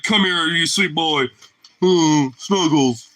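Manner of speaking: slow, cooing pet talk, lightly put on; doting dog voice. Owner cooing to his dog in bed, slow tender coo. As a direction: coo it like snuggling a puppy.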